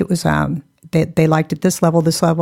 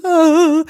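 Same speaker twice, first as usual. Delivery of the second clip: high startled ah; 11 semitones higher, 4 dB louder than usual. Retold or imitated. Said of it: "I was like".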